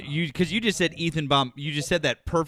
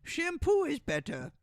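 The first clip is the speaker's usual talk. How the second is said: Billy Madison; 14 semitones higher, 7 dB quieter than usual. Acted. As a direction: imitate a silly man-child arguing in a high voice. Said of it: "Adam Sandler in, uh, Billy Madison".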